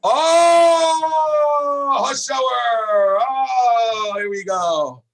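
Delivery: loud, high, long-drawn oh, easing down; big excited exclamation. Real, not acted. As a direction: cry out in delight at the answer, then settle. Teacher exclaims at student's answer.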